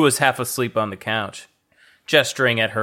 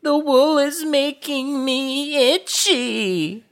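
much higher whine, stress on wear; whiny high Thea voice; slower than usual. Acted. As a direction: whine it like a sulky child. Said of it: "Thea whined".

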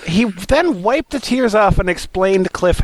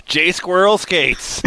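ordinary talk, nothing marked

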